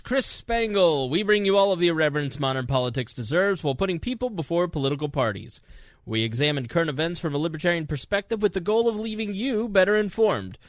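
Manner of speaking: upbeat podcast host read; bright show opener; announcer intro. Acted.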